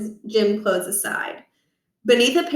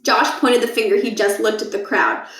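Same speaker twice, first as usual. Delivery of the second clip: usual speaking voice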